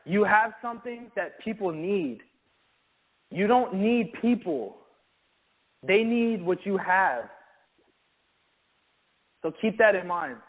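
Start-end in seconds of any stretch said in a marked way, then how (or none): 3.3-7.3 each word slow and weighty; slow, deliberate. Shown as a demonstration. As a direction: let it land slowly with confidence. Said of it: "Be confident".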